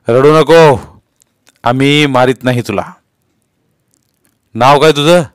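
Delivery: brisk official questioning; police inspector. A narrator's reading. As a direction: order a crying child to stop. Inspector questions a crying boy.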